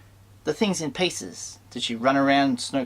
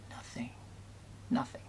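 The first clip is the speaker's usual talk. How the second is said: very soft, near-whispered; hushed disappointment; 16 dB quieter than usual. Real, not acted. Fan on favourite characters amounting to little, in hushed disappointment. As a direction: drop to a hush and let each word fall away.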